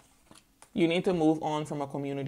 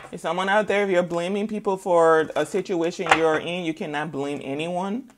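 usual speaking voice

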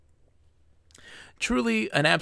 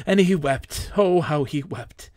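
melodramatic; 3 semitones higher, 3 dB louder than usual. A narrator's reading. Melodramatic climax of tragic story.

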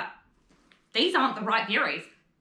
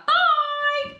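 chirpy farewell; 14 semitones higher, 5 dB louder than usual. Acted. Stepmother sends him off.

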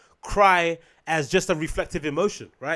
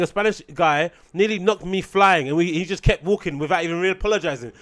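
ordinary talk

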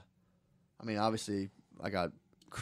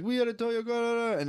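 high-pitched imitated Jamaican voice; garbled Jamaican-accented protest, pitched up; mock Jamaican accent; 10 semitones higher than usual. Jamaicans telling the kid off, mock Jamaican accent. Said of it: "the Jamaicans 'cause they were like".

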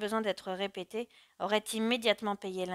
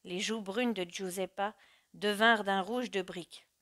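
ordinary delivery

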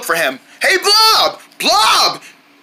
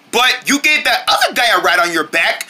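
normal talking voice

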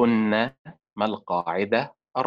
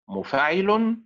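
مفاعل pitched higher, beat moved to another syllable; second stress pattern; 7 semitones higher, slower than usual. Shown as a demonstration. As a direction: shift the stress inside مفاعل. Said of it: "وتنطق بأربع طرق، هكذا".

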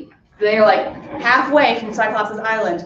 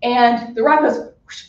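ordinary talk, nothing marked

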